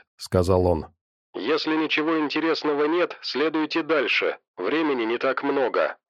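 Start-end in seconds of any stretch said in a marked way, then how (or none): 1.4-6.0 quiet, distant headset voice; like over a radio headset; through headphones. Acted. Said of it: "в наушниках голос учёного".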